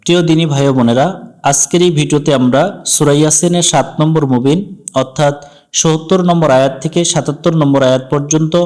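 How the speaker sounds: normal talking voice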